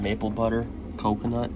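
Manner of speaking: ordinary talk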